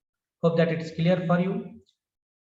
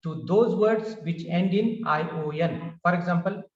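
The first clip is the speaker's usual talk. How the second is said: usual speaking voice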